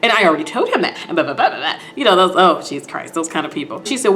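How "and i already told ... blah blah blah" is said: imitating an entitled customer; put-on indignant complaint; mock entitlement with a bit of laughter. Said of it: "she's really entitled too".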